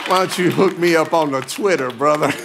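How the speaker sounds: normal talking voice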